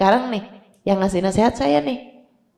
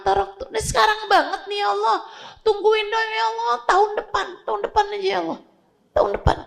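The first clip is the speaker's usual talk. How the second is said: high, pleading voice of a dying man bargaining; desperate begging; 8 semitones higher than usual. Acted. Dying sinner begs Allah for delay, high desperate pleading. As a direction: whine and beg for just a little more time.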